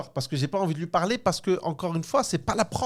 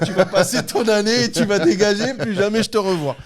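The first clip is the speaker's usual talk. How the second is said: laughing; 7 semitones higher, 8 dB louder than usual. Retold or imitated. Teacher quote, speaker laughing.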